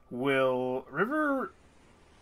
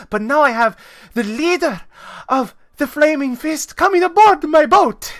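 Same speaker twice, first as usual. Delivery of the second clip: higher and louder, building to near panic; rising alarm; 12 semitones higher, 9 dB louder than usual. Acted. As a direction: build up the worry as the danger sinks in. River alarmed over the Flaming Fist.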